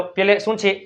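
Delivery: ordinary delivery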